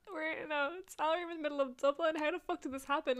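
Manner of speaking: baffled mock panic; incredulous. Waking up stunned in Dublin.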